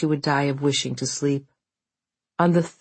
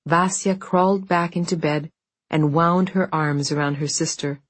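ordinary delivery, nothing marked

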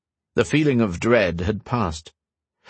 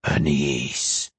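low, long hissing voice in the dark; drawn-out hiss; 7 semitones lower than usual. Acted. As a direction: stretch the name into a low hiss. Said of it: "The voice was a hiss in the dark, long and drawn out".

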